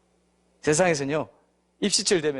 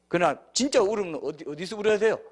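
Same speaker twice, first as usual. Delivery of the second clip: usual speaking voice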